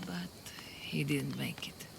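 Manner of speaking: whispered, trailing into grief; sad whisper. Acted. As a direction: let it fall to a whisper. Friend died trying to escape.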